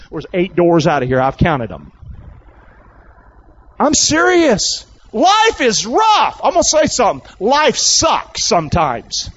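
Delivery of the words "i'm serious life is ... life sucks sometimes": high, drawn-out pulpit emphasis; emphatic preaching. Real, not acted. Preacher insisting to congregation that life is rough, emphatic. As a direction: stretch the words and press the point high.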